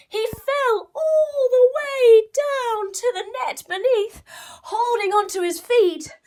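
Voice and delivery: high, loud crying-out narration; dramatic fall. Acted. Narrator tells the mouse falling with rising alarm.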